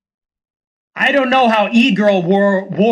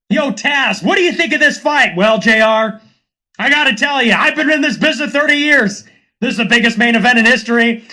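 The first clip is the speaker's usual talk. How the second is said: wrestling commentators; 2 semitones higher than usual. Acted. Commentators discussing the fight, hammed-up hype. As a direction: sell the fight like a veteran commentator.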